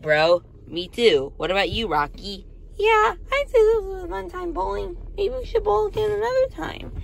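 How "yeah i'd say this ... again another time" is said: squeaky toy character, much higher; light cartoon plush voice; high plushie voice. Rocky plushie speaks.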